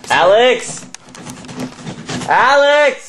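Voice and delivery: shouting a name across the house; calling from far away. Real, not acted. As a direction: call out loud for a friend. Calling a missing friend.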